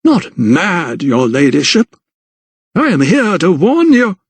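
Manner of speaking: affronted correction, swooping high; intoned, climbing protest; indignant vicar. A narrator's reading. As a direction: insist on your sanity with wounded dignity. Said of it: "He seemed indignant".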